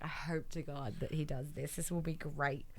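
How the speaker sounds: soft, low whispered wish; whispered hope. Retold or imitated. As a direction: whisper it in secret. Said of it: "I was like".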